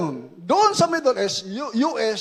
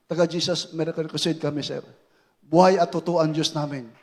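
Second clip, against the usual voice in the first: much lower, softer answer to the officer; meek reply; 10 semitones lower, 6 dB quieter than usual. Retold or imitated. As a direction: respond humbly, low.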